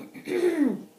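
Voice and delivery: short, loud throat clear. Shown as a demonstration. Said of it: "clear your throat".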